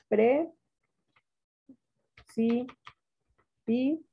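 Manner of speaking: "Pre, si, pi" with a long pause after each syllable. Shown as a demonstration. Teacher dividing precipicio for pupils, syllable by syllable. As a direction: sound out each syllable separately and wait. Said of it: "Cómo van a dividir precipicio".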